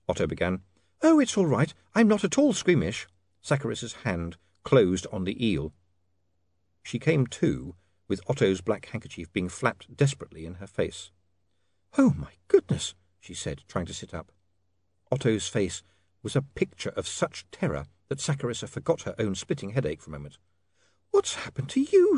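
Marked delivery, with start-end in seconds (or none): 1.0-3.1 put-on high, breezy young lady; young woman's voice. Sacharissa reassures Otto in dialogue. 12.0-12.9 very high woman's voice; dazed young woman. Acted. Sacharissa coming round from faint. 21.1-22.2 very high, startled lady; alarmed young woman. Acted. Sacharissa shocked at Otto's look.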